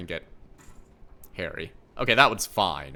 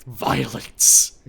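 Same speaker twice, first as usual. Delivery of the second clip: loud shout; 9 dB louder than usual. Acted. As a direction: belt it out gleefully. Shouting game text gleefully.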